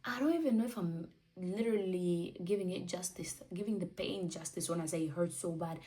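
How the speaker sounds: ordinary delivery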